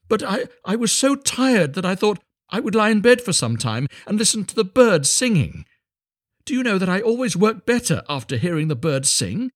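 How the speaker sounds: high, earnest little fellow; meek little Hans, apologetic. A narrator's reading.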